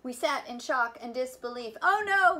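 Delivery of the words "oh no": high, loud anguished moan. Acted. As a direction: wail it in dismay. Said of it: "we moaned".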